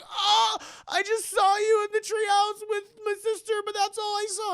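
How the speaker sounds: hammed-up high-pitched panic; acted terror. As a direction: blurt out a panicked confession. Terrified boy confesses.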